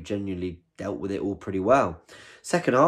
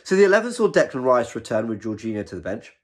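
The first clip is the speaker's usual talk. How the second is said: ordinary delivery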